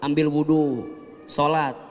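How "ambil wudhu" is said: ordinary talk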